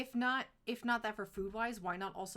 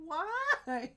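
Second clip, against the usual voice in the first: put-on whiny kid; 8 semitones higher, 7 dB louder than usual. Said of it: "And you're like".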